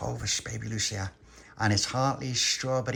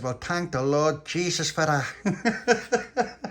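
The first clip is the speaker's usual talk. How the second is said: slow pious thanks; 6 semitones higher, 3 dB louder, much slower than usual. Acted. Friend voiced in a story.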